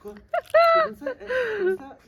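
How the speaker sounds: very high squeaky imitation; squeaky mock voice. Mimicking someone, very high.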